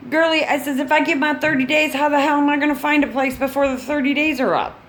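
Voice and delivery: high, hurried, exasperated protest. Retold or imitated. Renter retorts about the thirty-day deadline, exasperated.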